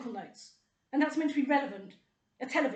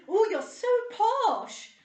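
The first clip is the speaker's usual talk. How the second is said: high, loud, very slow mock British accent; exaggerated British accent, drawn out high and loud; 9 semitones higher, 8 dB louder, much slower than usual. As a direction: send up a British woman's accent, stretching every word. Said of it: "One woman with a British accent".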